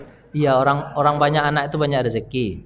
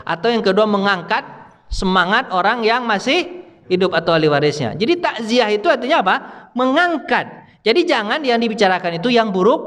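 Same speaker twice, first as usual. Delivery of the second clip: raised, high teaching voice; forceful preaching; 8 semitones higher, 3 dB louder than usual. Acted. Preacher explaining takziah to the congregation, forceful preaching. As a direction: press the definition home to the crowd.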